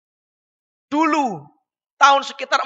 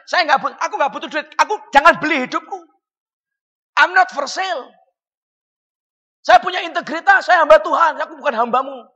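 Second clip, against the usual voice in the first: higher, louder, indignant preaching; fervent refusal; 6 semitones higher, 5 dB louder than usual. Retold or imitated. Preacher retelling refusing a donor's money, heated and loud.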